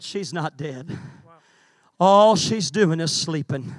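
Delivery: very soft and low; hushed. Acted. Jesus telling Jairus his daughter sleeps, very hushed. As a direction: let it fall to almost nothing.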